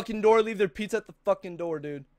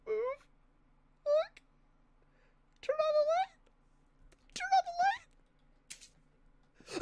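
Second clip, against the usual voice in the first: high tense whisper; faster than usual. Real, not acted. Frightened tense commands to self.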